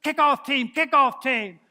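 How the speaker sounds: loud, high sideline call for the kickoff team; coach hollering. Retold or imitated. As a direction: bellow it across the field like a coach. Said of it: "they're hollering for the kickoff team".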